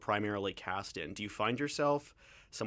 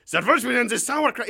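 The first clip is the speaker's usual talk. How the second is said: mock German dictator accent, much higher and louder; Charlie Chaplin German accent; 12 semitones higher, 13 dB louder than usual. Host does a movie dictator impression in a mock German accent. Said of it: "It's just Charlie Chaplin from The Great Dictator".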